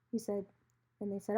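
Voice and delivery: ordinary talk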